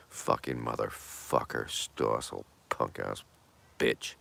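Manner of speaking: low, soft muttered insults; cursing the host, soft and low; muttered curse. Real, not acted. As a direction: grumble it under your breath. Cursing Stossel under his breath.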